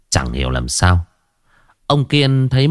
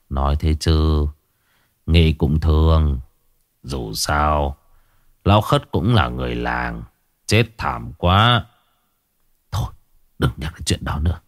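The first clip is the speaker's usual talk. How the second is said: put-on somber old man; 5 semitones lower, 3 dB quieter than usual. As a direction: drop your voice, remembering the dead man. Old man sombre at drinks.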